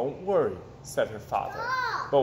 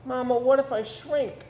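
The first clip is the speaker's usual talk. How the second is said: put-on worried child; 8 semitones higher than usual. Child voice asking mother.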